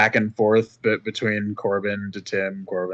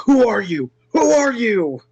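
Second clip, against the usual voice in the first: high, raised-voice demand; insistent, high and louder; demanding interrogation; 12 semitones higher, 5 dB louder than usual. Acted. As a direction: press the captive hard for an answer. Interrogating a man in a headlock.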